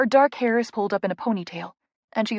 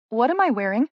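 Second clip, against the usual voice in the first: puzzled question; 3 semitones higher than usual. A narrator's reading. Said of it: "first asking, confused".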